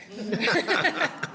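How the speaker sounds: brief real laugh; light laugh. Real, not acted. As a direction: chuckle while thanking him. Laughs thanking Tommaso.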